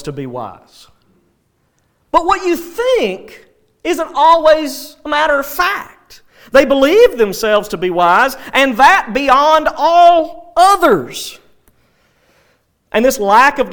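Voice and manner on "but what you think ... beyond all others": high, emphatic preacher's delivery; forceful preaching. Real, not acted. Preacher warning that belief is not fact, forceful preaching. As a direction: press each phrase on the listeners.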